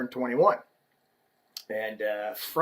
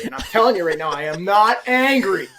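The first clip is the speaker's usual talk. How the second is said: louder and higher, protesting he is not angry; mock angry denial; 8 semitones higher, 7 dB louder than usual. Guest defending himself to hosts, hammed-up protest. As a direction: insist you are not angry, getting heated. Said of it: "seem to think that I'm angry".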